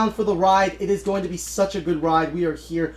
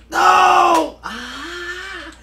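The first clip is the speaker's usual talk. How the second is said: high, loud cry of dismay; sudden shout; 12 semitones higher, 6 dB louder than usual. Real, not acted. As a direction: yelp it out at the screen.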